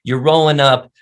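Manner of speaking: ordinary talk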